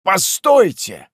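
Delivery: slow, high, loud halt; commanding interjection. Acted. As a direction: cut in with a drawn-out shout. Guest interrupts the shouting master.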